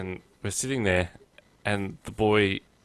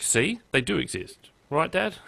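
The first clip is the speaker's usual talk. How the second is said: put-on higher-pitched, vindicated little boy; triumphant child; 7 semitones higher than usual. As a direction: crow it like a kid proved right. Retelling triumphant son.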